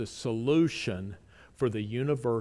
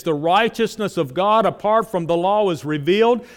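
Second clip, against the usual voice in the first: loud, raised preaching voice; forceful preaching; 4 semitones higher, 9 dB louder than usual. Acted. Preacher booming Romans to the congregation, loud and raised. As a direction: drive the verses home from the pulpit.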